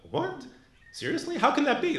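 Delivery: high incredulous What, Seriously; incredulous disbelief. Retold or imitated. Shocked inner reaction to title.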